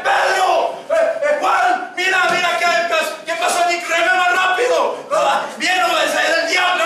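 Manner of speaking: high, acted alarm, calling to the crew; panicked shouting. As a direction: yell to your mates in hammed-up panic. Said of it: "él empezó a gritar".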